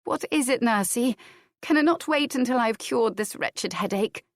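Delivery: a lightly voiced lady with a headache; Adair, weary. A narrator's reading.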